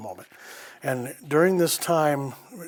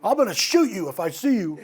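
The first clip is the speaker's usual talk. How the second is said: high, loud shout at the intruder; grandma yelling at a prowler, loud and fierce; grandma's threat; 11 semitones higher, 9 dB louder than usual. Retold or imitated. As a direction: yell the threat into the dark. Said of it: "she's looking around".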